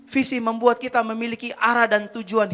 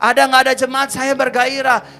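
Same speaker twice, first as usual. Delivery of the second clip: loud, raised, driving sermon delivery; fervent preaching; 6 semitones higher, 10 dB louder than usual. Real, not acted. Preacher declares his zeal to the congregation, fervent preaching. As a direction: build up and proclaim it with full passion.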